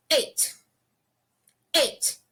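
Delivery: Eight short and crisp, no stretching; quick eight. Shown as a demonstration. Teacher showing students a quick Eight. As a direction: clip Eight off fast. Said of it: "O oito é rápido".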